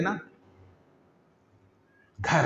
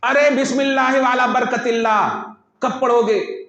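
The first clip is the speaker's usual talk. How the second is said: loud reproach; 7 semitones higher, 5 dB louder than usual. Retold or imitated. Scolding someone at the table.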